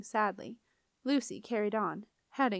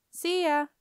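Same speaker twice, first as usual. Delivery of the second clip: high, loud called-out goodbye; yelled farewell; 8 semitones higher, 5 dB louder than usual. A narrator's reading. Barge boy to Lucy as the barge draws away, high loud yell. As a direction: yell it across the water. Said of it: "yelled the barge boy".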